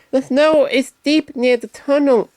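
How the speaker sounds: Percy warning the others about the snow, high and urgent. Acted. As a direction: warn them like an anxious little engine. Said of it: "he warned them".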